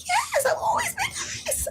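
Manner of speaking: high, laughing shout of a retort. Real, not acted. Answering people surprised she's nice, a high laughing shout. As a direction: laugh it out indignantly.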